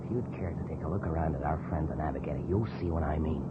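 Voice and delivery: low, hushed threat; soft whispered menace; menacing whisper. Acted. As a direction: breathe the warning so only he hears. Menacing warning about the navigator.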